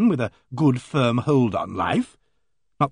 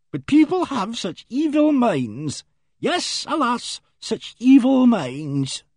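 high, slow pious lament; prim spinster voice; 10 semitones higher, slower than usual. Acted. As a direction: savour the gossip slowly. Miss Ginch continues.

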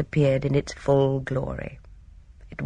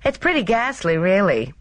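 higher, breezy character voice; cheerful; 6 semitones higher than usual. A narrator's reading. Gina describing Stonygates to a visitor, cheerful. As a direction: toss off the gloomy verdict cheerfully. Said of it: "said Gina cheerfully".

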